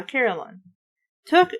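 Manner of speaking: ordinary delivery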